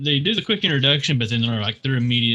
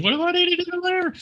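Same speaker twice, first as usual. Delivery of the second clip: much higher, strained mock-crying voice; mock blubbering. Admitting sad movies break him, mock blubbering much higher. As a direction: squeak it out like you're about to bawl. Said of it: "I get all blubbery".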